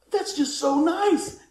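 high, slow imitation of delight; thrilled, drawn-out appreciation; excited lady. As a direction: gush it, stretching the words. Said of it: "she was so excited".

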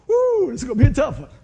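loud high whoop then loud words; whoo exclaim. Real, not acted. Preacher whooping to the church before a hard story. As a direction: let out a whoop.

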